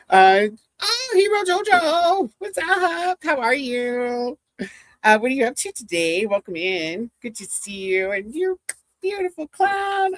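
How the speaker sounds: high, bubbly hello to the chat; squealing welcome to friends; excited greeting. Real, not acted. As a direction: gush over each viewer by name. Greeting chat viewers on stream.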